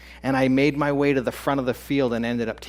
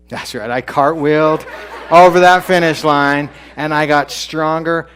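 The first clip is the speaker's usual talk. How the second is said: louder and higher, laughing in triumph; triumphant shout; 5 semitones higher, 12 dB louder than usual. Real, not acted. To the audience at the story's climax, a triumphant shout. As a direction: shout the win over the finish line.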